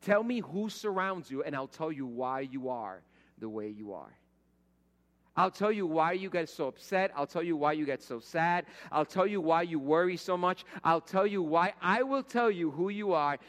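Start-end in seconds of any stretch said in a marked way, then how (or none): 5.4-13.4 louder, rising repeated promises; building preaching. Acted. Pastor pressing the congregation, louder with each repetition. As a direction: hammer each I'll tell you harder.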